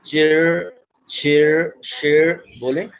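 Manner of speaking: चिर and शेर, slow and clear; all three sounds. Shown as a demonstration. Teacher models all three blends together, slowly. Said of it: "मैं इकट्ठा बोलता हूँ".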